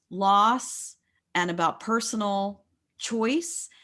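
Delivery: ordinary talk, nothing marked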